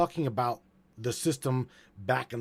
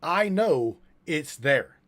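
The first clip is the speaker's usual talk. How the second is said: slow, loud, word-by-word quote; raised voice, drawn-out delivery; emphatic quote; 3 semitones higher, 5 dB louder, slower than usual. Retold or imitated. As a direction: quote it deliberately, spacing out each word. Repeats the claim after "Quote.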